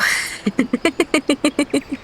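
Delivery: real giggle, airy and high; can't hold the laugh in; high burst of laughter. Real, not acted. Laughs at her own oath.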